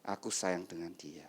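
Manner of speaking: low, soft loving murmur; acted intimate confession, hushed and low; tender declaration. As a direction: say it quietly, beside a sleeping spouse. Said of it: "Kamu berkata".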